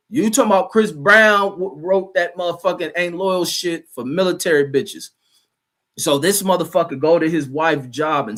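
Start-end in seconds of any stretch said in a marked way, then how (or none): none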